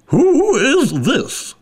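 high, drawn-out imperious demand; stern, high-pitched queen voice; severe queen. A narrator's reading. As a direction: stretch it out slowly, high and haughty. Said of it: "the Queen said severely".

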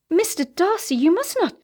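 high, urgent plea to stop; crying out in protest. A narrator's reading. Shocked woman protesting to the man who kissed her, high cry. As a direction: cry out, pitch leaping up. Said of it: "she cried".

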